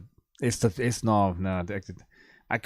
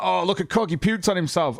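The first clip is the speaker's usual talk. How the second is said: sneering, pitched up, a bit louder; mock him like a gloating troll; 7 semitones higher, 4 dB louder than usual. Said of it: "It's like".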